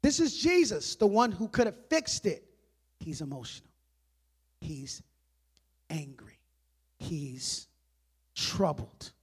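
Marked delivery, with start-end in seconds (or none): 3.0-9.1 low, soft, with long pauses; hushed gravity. Real, not acted. Preacher naming what Jesus felt, hushed and grave. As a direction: let each word sink in quietly.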